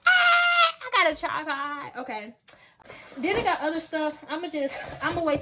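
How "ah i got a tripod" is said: high, excited intro voice with a sung name; mock unboxing host, hyped up; hyped vlog intro. Hyping up her viewers for a new tripod, excited. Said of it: "I'm gonna be like".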